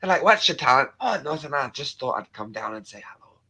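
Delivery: lively put-on Scottish voice; Scottish accent. Streamer disclaiming any talent, mock Scottish accent. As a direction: protest in broad Scottish. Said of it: "your Scottish accent".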